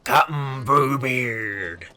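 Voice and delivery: slow, hammed-up pirate captain; lightly voiced pirate announcing himself. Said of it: "I'm committing to this bit".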